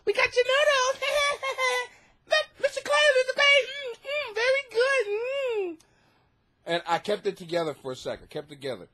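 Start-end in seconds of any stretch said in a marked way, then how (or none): 0.0-5.8 put-on very high sing-song child voice; taunting little girls. Little girls teasing a man about his noodles, high mocking voice. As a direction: mimic kids gloating in a squeaky teasing voice. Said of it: "now little girls start going at".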